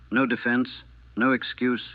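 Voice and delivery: usual speaking voice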